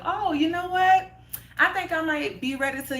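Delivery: high-pitched dawning idea; retold bright idea. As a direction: muse aloud, voice lifted high. Retold change of heart, musing.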